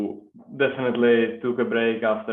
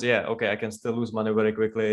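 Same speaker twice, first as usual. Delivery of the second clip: usual speaking voice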